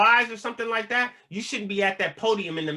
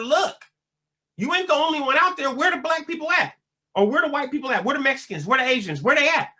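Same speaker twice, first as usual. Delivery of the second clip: higher, urgent questioning voice; asking around; 9 semitones higher than usual. Retold or imitated. New inmate asking his homeboys where each race's bars are, pitched high and pressing. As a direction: call out to the homeboys about where everyone stands.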